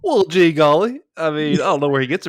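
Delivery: chuckled mock folksy exclamation; laughing. Real, not acted. Reacting to teasing, laughing.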